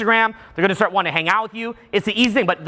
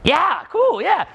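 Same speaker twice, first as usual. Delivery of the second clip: eager yes; 9 semitones higher, much slower than usual. Retold or imitated. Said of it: "And I'm like".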